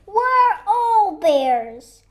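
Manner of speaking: loud, high, drawn-out holler; gleeful shout. Acted. Squirrel boasts they are all bears, gleeful shout. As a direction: belt it out slowly and playfully. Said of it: "shouted Squirrel".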